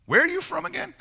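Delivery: high-pitched, hammed-up nervous question; Pilate. Worried Pilate asks Jesus, comically high-pitched. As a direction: squeak up high, played for laughs. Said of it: "So he asks him".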